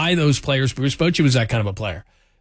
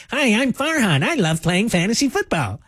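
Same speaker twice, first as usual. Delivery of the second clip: high-pitched geeky guy, mocking; Farhan; 8 semitones higher than usual. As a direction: chirp it like an eager nerd. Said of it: "he was this geeky guy".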